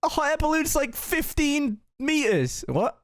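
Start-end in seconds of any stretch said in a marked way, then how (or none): none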